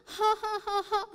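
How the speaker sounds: high mocking child's cackle; gleeful laugh. A narrator's reading. Riki gloating over the stolen shoes, cackling. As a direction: cackle with glee at the trick. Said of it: "dia senang".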